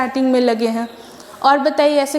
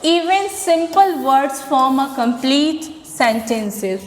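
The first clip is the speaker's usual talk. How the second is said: very slow, word by word English reading; slow classroom English read-out; slow dictation; much slower than usual. Acted. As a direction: read it slowly for students to copy.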